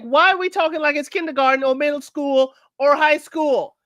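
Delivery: hammed-up grown-up complaint; imitating Titus. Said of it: "He's like".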